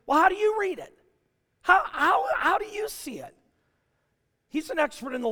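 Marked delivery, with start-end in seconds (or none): 0.1-3.3 Jesus asking back. Acted. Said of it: "he looks at the young guy and he goes".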